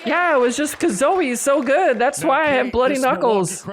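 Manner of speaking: mocking Kate Fahey.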